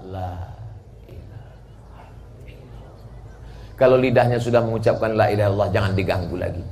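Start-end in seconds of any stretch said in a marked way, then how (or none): 0.1-2.9 fading breath. Acted. Only the tongue speaks.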